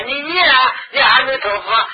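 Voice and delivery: high, loud, half-crying shout; shouting urge. Retold or imitated. Relatives yelling at the dying to recite Amitabha. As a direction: yell at the dying man to chant. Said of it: "叫人家".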